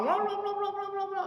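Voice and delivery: high-pitched blah blah nagging; mock nagging babble. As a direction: squeak out the angry wife's rant. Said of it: "istrinya Sayyidina Umar marah-marah". (omelan istri yang ditirukan tinggi)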